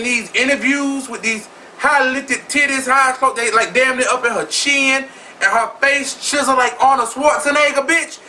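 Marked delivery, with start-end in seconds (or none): none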